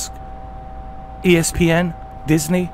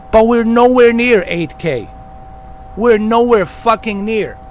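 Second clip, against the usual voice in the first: normal talking voice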